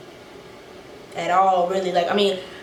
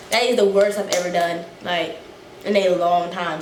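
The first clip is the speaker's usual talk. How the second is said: usual speaking voice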